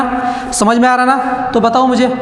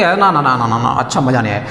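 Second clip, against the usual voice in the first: quick, lower-pitched mock student grumble; lightly voiced complaining pupils; 7 semitones lower, faster than usual. Teacher imitates pupils rejecting the formula, quick low grumble. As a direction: rattle off the refusals like a dissatisfied pupil. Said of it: "कुछ बच्चे बोलोगे".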